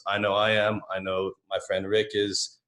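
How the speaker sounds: ordinary delivery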